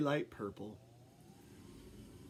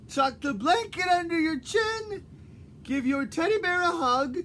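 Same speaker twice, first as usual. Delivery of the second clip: high, loud doting grandma; Granny voice; 11 semitones higher, 5 dB louder than usual. Acted. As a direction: tuck him in like a fussing granny. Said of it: "my Granny voice".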